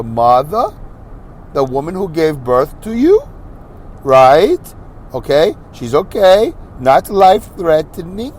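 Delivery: measured phrases, each lifted at the end; deliberately slow. Retold or imitated. Nurse giving news about his mother, deliberately slow. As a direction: spell it out gently in slow, separated chunks. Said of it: "I'm gonna speak slowly".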